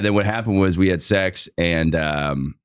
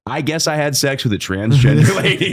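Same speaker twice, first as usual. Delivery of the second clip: laughing; 7 semitones higher, 4 dB louder than usual. Retold or imitated. Retold realisation, laughing.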